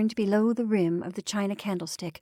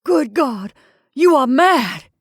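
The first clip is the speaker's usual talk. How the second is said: loud, horrified exclamation; appalled outburst; aghast; 5 semitones higher, 11 dB louder, slower than usual. A narrator's reading. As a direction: cry out aghast, building to a higher pitch.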